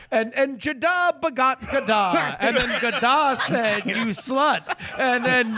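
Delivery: mock scripture reading. Mock Old Testament reading.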